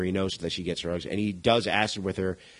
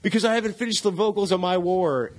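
higher-pitched quoted comeback; imitated Rollins's protest; 9 semitones higher than usual. Retelling Rollins's answer to the girl driving, raised-voice protest. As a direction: give the excuse in a raised, insistent voice. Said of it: "And Rollins is like".

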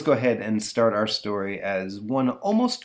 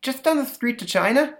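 put-on much higher kid voice; innocent boy; 4 dB louder than usual. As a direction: answer dad with innocent cheek. Boy answers dad innocently.

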